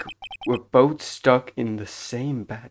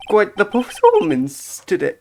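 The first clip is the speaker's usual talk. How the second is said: louder, higher, croaky character line; croaking voice; 8 semitones higher, 10 dB louder than usual. Acted. Bellboy complimenting a performance, voice breaking into a croak. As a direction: voice cracking upward like a frog. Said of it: "My fucking voice is frog croaking".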